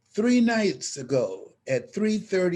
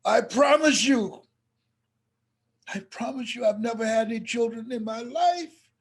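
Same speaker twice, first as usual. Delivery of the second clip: crying promise; 7 semitones higher than usual. Retold or imitated. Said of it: "And Mama started crying".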